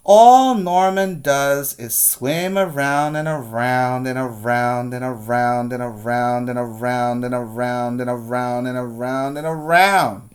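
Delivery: endless sing-song around and around; droning repetition. Acted. Boy complaining about his boring fish, droning on. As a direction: drag it out like it never ends.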